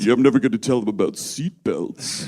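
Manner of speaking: very low character impression; Tony J. Scientist father keeping a secret, deep put-on voice. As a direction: drop far down into a put-on father voice. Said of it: "that's an accurate Tony J impression".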